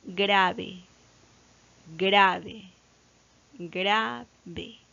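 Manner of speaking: "grave" said separately each time, GRA held; deliberate dictation of "GRAA-ve"; stress on GRA. Shown as a demonstration. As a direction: stretch the "gra" in each "grave", letting "ve" drop. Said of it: "La sílaba tónica está en gra".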